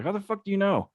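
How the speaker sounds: mock outrage at the handler; incredulous retort.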